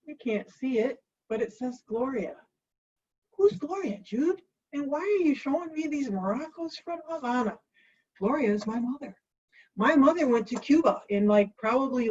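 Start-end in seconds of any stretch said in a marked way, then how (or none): 3.4-7.6 high-pitched mock questioner; imagined viewer's question. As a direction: pipe up higher, playing a puzzled viewer. Voices a viewer questioning her.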